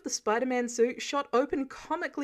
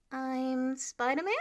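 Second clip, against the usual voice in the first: unsure; 3 semitones higher, slower than usual. Acted. Peter unsure of his name.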